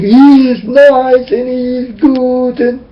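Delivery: a hint of sing-song mockery of the faithful; mock naive believer. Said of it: "because you will think".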